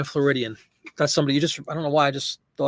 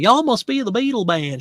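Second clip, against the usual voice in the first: put-on heavy Southern accent; 8 semitones higher than usual. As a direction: do her in a thick Southern accent. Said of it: "she had a really heavy Southern accent".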